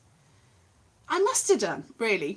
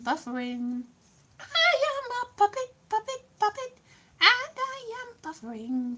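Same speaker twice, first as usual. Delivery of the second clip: high, slow, soft puppet voice; a lovely little puppet introducing herself; 4 semitones higher, 4 dB quieter, slower than usual. Pretend. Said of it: "That was my little interlude".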